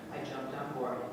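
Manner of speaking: ordinary talk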